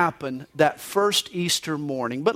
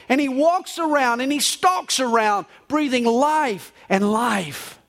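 emphatic storytelling, higher as it builds; dramatic poem recital; 4 semitones higher than usual. Acted. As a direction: recite it like a rising proclamation.

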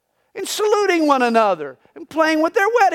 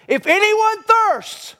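high, slow ringing call, much higher; preacher's shout; 6 semitones higher, slower than usual. Retold or imitated. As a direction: cry it out from the back of the temple. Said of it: "he shouted".

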